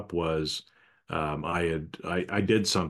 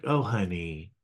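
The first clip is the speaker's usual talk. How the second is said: condescending honey. Retold or imitated. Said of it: "derogatory way of, of almost kind of putting women down".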